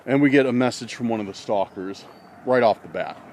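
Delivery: ordinary talk, nothing marked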